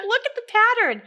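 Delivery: high, loud mock-upset plea; imitated upset insistence. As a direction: imitate a friend desperate to be believed. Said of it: "she's getting upset".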